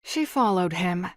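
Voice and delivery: plain reading voice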